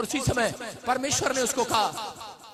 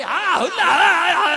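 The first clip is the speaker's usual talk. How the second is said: rowdy street shouting; 6 semitones higher, 8 dB louder, slower than usual. Retold or imitated. Mocking crowd brawl outside.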